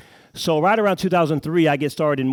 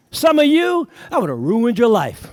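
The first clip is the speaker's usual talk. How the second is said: mock outburst; 10 semitones higher, 6 dB louder, slower than usual. Mock overreaction to broken car.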